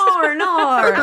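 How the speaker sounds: Australian accent. Shown as a demonstration. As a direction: drawl "R and R" in Australian through laughter. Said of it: "It works".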